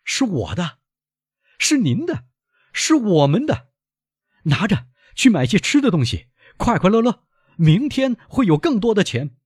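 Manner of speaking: high, loud, exuberant; jubilant son. Acted. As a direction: share your joy, building in volume. Son rejoices over the money.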